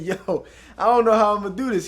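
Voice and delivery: nervous laugh through the words. Retold or imitated. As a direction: laugh it out, unsure and loud. Nervous laughing quote to mentor.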